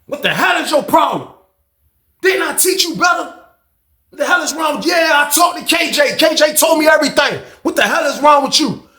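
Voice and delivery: high, loud furious shouting into the phone; furious phone yelling. Retold or imitated. Banks yelling at his daughter on the phone, furious. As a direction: scream at her down the line. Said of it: "he loud as hell".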